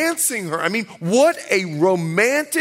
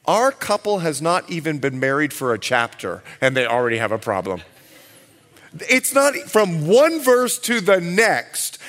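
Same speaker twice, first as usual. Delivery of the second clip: ordinary talk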